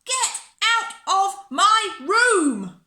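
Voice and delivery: put-on slow, high, furious child's shout. A narrator's reading. Bella orders baby Bob out of her room, shouting. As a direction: shout each word loud and drawn out. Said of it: "So I shouted".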